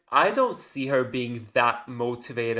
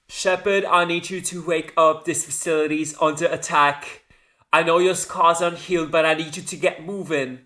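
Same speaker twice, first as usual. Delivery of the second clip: urgent loudspeaker orders, raised and brisk; Miranda; 4 semitones higher, 5 dB louder than usual. Retold or imitated. Miranda directs waking Shepard over the loudspeaker, urgent and commanding. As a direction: imitate a commanding tutorial lady, louder and higher. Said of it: "Miranda over the loudspeaker giving you directions".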